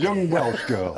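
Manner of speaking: high, laughing teasing voice; laughing tease. Real, not acted. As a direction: tease her through a laugh. Teasing about the young singer.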